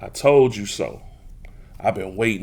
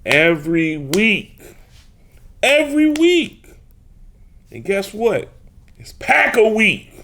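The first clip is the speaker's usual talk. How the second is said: rising gleeful yell, every week louder and higher; taunting shout; 10 semitones higher, 5 dB louder than usual. Real, not acted. To Bears fans, shouting gleefully. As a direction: rub it in, shouting it.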